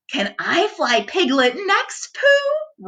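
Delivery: high eager little kangaroo voice; small child begging; Roo voice. A narrator's reading. Roo voiced in read story.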